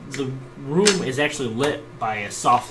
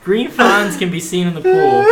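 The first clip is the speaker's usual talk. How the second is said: game master narration; 8 semitones higher, 10 dB louder than usual. Acted. Scene narration, repeated.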